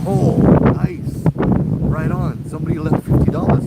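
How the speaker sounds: loud, high burst of acted delight; delighted discovery. Future self finds fifty dollars, delighted discovery. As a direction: exclaim like you've stumbled on free money. Acting the delighted finder of money.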